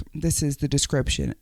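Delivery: normal talking voice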